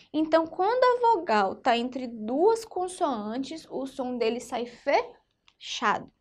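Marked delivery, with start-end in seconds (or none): none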